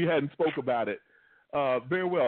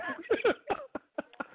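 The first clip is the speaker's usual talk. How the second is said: high laugh; 3 dB quieter than usual. Real, not acted. Host laughs at rollover joke.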